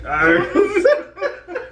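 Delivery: high shrieking laugh; high squealing laugh. Real, not acted. Laughing at food.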